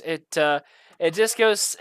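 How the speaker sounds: ordinary delivery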